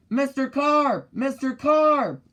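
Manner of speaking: high, loud, slow calls of Mister Carp; boy anxiously calling Mister Carp by the pool; yelling. Acted. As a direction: call out across the pool. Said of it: "I yelled".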